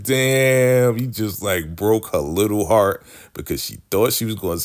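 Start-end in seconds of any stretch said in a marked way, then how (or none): 0.0-1.0 damn. Real, not acted. Host reacts to rejection.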